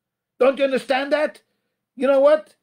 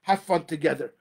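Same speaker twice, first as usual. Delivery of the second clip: a hint of a scoffing listener, much softer and lower; mock dismissive listener; 6 semitones lower, 4 dB quieter than usual. Rabbi imitating a listener who scoffs, softly dismissive. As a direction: brush the advice off as too simple, half-mocking. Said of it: "I know what you're saying".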